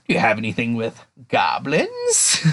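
imitated single-minded slayer. Slayer asking at the guild counter, mock solemn. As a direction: ask it slow and low-key, mock serious.

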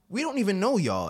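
high, loud mock disbelief; incredulous. Players reacting to strangers joining practice, loudly incredulous.

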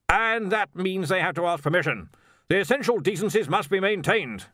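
firm. A narrator's reading. Arch-Chancellor insists on decencies to Stibbons, firm. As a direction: lay down the rule with authority. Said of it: "said Ridcully firmly".

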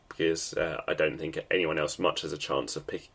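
ordinary talk

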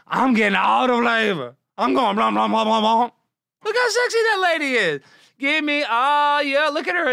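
high, loud hammed-up drooling voice; mock lust. Streamer pretends to drool over a photo, high and loud.

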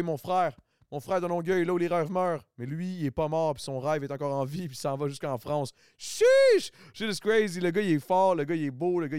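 tipsy, loud and hyped. Real, not acted. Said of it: "c'est pas parce que je suis pompette".

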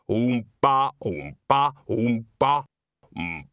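plodding oom-pah that stops on a hum; imitated tuba petering out. A narrator's reading. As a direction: tuba imitation, halting mid-beat. Tuba fading under conductor's gaze.

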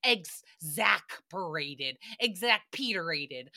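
"exasperated" stretched very slowly, pitched low; low, slow-motion "exasperated"; drawn-out word. Shown as a demonstration. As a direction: savour "exasperated" syllable by syllable.

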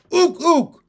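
very high, loud froggy hoots; two piercing, excited hoots; gleeful shout. A narrator's reading. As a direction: whoop it like a delighted frog. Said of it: "shouted Pin with glee at every".

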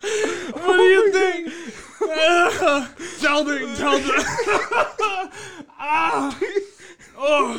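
laughing. Real, not acted. Cracking up over the tattoo.

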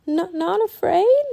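put-on high, halting old woman; weak, stammered question; feeble voice. A narrator's reading. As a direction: falter it out in disbelief. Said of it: "said a feeble voice".

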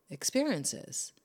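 ordinary delivery

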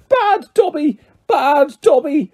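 very high, frantic elf cries; shouting elf. A narrator's reading. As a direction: squeal in self-punishment, stretching the repeat.

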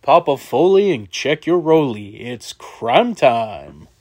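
rhythmic hype intro, very drawn-out ending; Crime Time impression. Host finishing the wrestling routine, stretched catchphrase voice. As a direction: land the catchphrase long and slow. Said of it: "do the Crime Time thing".

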